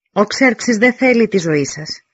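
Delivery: loud, high and slow call up the wall; slow loud proclamation; envoy shouting. Acted. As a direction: herald calling out over the dead. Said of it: "φώναξε ο Τόμι".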